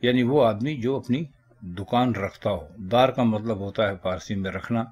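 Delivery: ordinary talk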